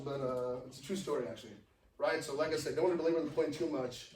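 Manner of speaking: usual speaking voice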